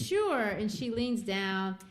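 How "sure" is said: much higher and louder quick "sure"; a hint of an eager minister; eager agreement. Minister answering the little girl, eager high yes. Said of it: "And he says".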